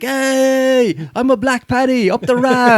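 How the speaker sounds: very high, loud street yell; imitated shouting. Comedian shouting at people on the side of the street, high mock shout. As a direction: holler it across the street, mimicking the comedian. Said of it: "he was shouting at the people".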